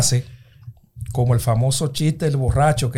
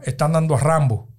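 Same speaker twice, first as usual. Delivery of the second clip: ordinary delivery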